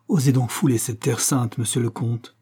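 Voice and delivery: usual speaking voice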